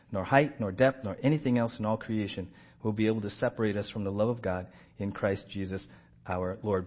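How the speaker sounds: low, soft recitation from the Bible; scripture reading. Acted. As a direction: read the verses quietly and evenly, low in pitch. Reads scripture aloud.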